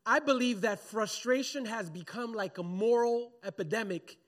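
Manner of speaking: ordinary talk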